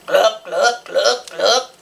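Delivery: throaty pig-like gluck sounds; greedy grunt. Acted. Pig grunting greedily for food, throaty glucks. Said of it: "then greedily grunted".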